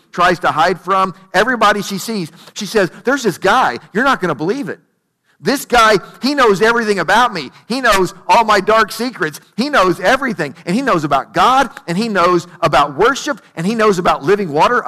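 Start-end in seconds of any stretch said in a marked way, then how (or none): 3.0-14.9 excited, piling one claim on the next; fired up. Acted. Woman telling everyone in town about Jesus, fired up. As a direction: gush it out, punching each new discovery. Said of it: "she is fired up".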